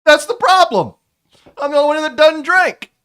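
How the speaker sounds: put-on Melvin's loud, high voice wandering between accents; shifting-accent voice. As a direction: argue loudly, never settling on one accent. Melvin retorts to Zero.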